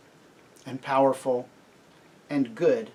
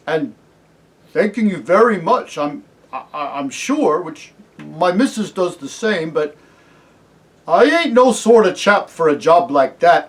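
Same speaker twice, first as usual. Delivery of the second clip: higher, loud Cockney working man; flustered humble cabbie; 4 semitones higher, 10 dB louder than usual. Acted. Cabbie declining a crown from Aslan, flustered. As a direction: stammer politely, protesting you are unfit.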